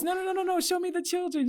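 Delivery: high, laughing mock insistence; laughing while imitating him; laughing quote. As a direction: push through the giggles as him. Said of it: "And he goes".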